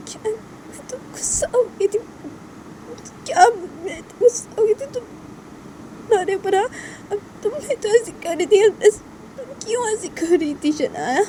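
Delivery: high, whimpering protest; tearful Tisha. Acted. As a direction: protest as if about to cry. (रुआँसी, ऊँची आवाज़ में शिकायत)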